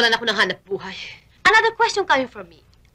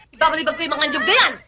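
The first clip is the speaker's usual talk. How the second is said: ordinary talk, nothing marked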